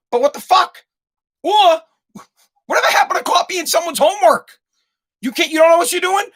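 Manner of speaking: mock exasperation. Ranting at son in retold scene.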